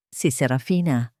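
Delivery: usual speaking voice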